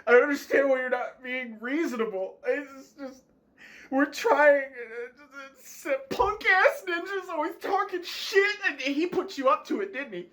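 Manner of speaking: high, sputtering outburst; exasperated rant. Acted. Twitch accusing Shroud's friend of putting them up to it, exasperated rant. Twitch loses temper at departing streamer.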